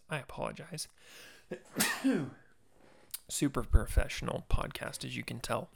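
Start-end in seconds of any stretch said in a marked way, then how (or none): 1.5-2.3 real sneeze into the mic; sudden sneeze, not held back; sneezing. Real, not acted. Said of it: "Sorry, I'm gonna sneeze".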